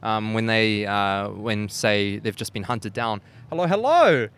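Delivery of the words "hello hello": loud happy greeting; calling out. Real, not acted. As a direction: call out hello across the room. Greeting someone arriving.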